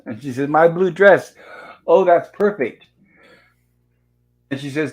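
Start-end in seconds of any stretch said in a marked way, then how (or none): 0.5-2.9 higher, louder mock delight; acted delight. Missus Howell told to wear her blue dress, acted delight. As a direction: gush it, drawing out the last words.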